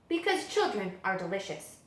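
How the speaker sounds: ordinary delivery, nothing marked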